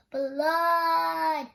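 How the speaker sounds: loud high sung-out cry; yelled. A narrator's reading. Loretta calling the police about her brother, loud high wail. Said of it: "and yelled".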